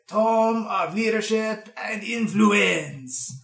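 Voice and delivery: put-on slow, drawn-out accented gnome voice; old bookkeeper reading. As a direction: savour each word of the title. Bookkeeper reads card from index.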